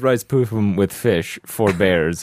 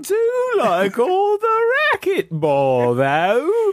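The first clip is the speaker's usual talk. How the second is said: very high, hammed-up English lady voice; subdued English lady; much slower than usual. Greeting the ladies for the listener, high English lady accent. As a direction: falsetto mock English lady, stretched out. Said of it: "subdued English lady".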